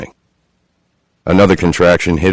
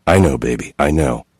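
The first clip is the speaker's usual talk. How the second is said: soothing; 4 semitones lower, 4 dB quieter than usual. A narrator's reading. Soothing furious wife.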